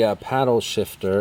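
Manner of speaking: normal talking voice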